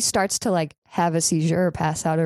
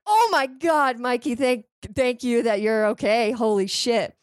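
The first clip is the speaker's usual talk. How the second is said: relieved exclaim; 5 semitones higher than usual. Retold or imitated. Mikey revives after not breathing.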